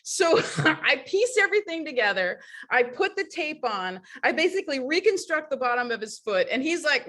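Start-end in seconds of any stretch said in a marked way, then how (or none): none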